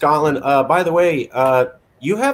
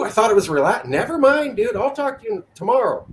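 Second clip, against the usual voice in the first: ordinary talk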